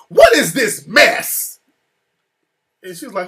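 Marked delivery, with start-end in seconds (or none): 0.1-1.6 loud, slow, outraged demand; retold outraged demand. Venue owner walking in on wife, retold outraged demand. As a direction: imitate an affronted venue owner, booming.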